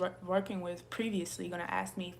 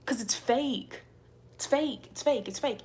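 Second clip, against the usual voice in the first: louder, higher insistence; emphatic repetition; 5 semitones higher, 5 dB louder than usual. Real, not acted. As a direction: hammer the word home each time. Insisting the subscribers are fake.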